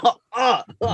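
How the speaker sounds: high-pitched cringing "oh" sounds; pained cries. Acted. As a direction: act out wincing at a gory scene. Acts out viewer's pained reaction.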